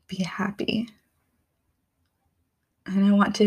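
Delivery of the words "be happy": breathy whispered wish; barely voiced, near tears; words fall to a whisper. Real, not acted. Tearful wish, voice drops away.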